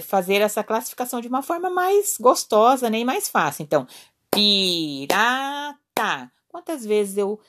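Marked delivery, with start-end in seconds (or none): 4.3-5.4 very slow, PI-RA-TA in three separate beats; syllable by syllable. Shown as a demonstration. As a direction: stretch each syllable of "pirata" to fit a clap. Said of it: "Quantas palmas? Três palmas". ("pirata" bem devagar, sílaba por sílaba)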